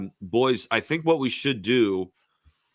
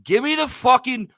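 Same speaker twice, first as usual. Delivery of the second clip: put-on British accent; 3 semitones higher than usual. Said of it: "Joseph and I can talk a British accents".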